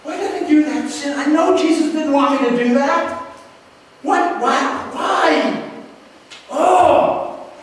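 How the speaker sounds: high, pained, pleading self-reproach; anguished remorse. Pretend. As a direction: wail at your own sin. Voicing a remorseful sinner.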